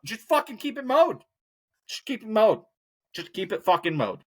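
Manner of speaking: high, fed-up outburst; exasperated. Real, not acted. Vlogger tells lazy neighbours to mow, high exasperated outburst. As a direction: throw up your hands and insist.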